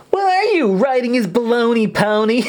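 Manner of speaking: put-on high, indignant girl voice. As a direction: scold him, shocked and indignant. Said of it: "Really indignant voice she has".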